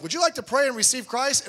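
high, loud cheery question; mock eager invitation. Soul winner asking an unconvinced lady to pray, over-eager.

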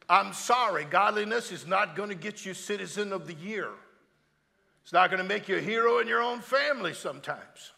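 loud, driving sermon delivery; fiery pulpit preaching; full-force preaching. Acted. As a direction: preach it out hard to the room.

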